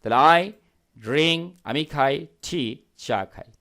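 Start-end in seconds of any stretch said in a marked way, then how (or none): none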